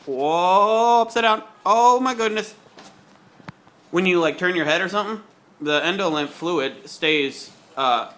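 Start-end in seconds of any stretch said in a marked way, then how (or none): 0.1-2.5 surprised. Real, not acted. Looking upside down while spinning.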